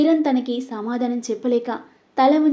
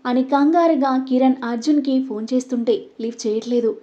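ordinary delivery

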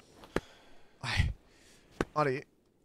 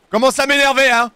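crie plus haut et plus fort, à bout; lâche sa colère d'un trait; colère criée; 9 semitones higher, 9 dB louder than usual. Real, not acted. Excédé après la chute. (crie son énervement contre le jeu)

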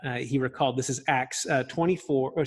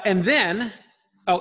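normal talking voice

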